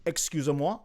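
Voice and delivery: put-on politely puzzled, with a French accent. Crew member challenged on sounding French, French accent. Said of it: "you definitely have a French accent now".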